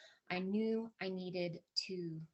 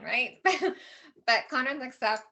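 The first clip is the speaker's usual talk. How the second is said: usual speaking voice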